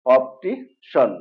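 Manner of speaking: "অপটিশন" slowly, ending in shon; correct SHON ending. Shown as a demonstration. Teacher breaks down the word, "অপটিশন" slowly with shon. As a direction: stretch "অপটিশন" as op-ti, then shon. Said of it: "শন, অর্থাৎ".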